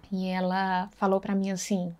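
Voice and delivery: normal talking voice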